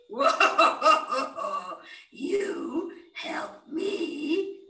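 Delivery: loud scornful laugh then slow question; laughing lion. Acted. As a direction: laugh at the tiny mouse.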